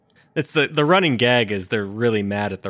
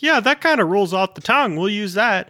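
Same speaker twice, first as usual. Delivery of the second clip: dopey voice; 11 semitones higher, 5 dB louder than usual. Retold or imitated. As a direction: say it like a cheerful dimwit. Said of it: "they just kinda were like".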